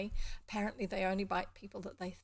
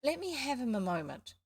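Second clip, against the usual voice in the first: put-on higher, falling child's plea; begging; 5 semitones higher than usual. A narrator's reading. Child begs to hold the puppy, pleading. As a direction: plead for a turn. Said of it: "begged Bobby".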